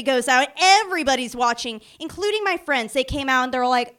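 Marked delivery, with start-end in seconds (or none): none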